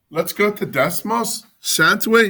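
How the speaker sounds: mock British teacher voice; playful fake British accent. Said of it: "British accents".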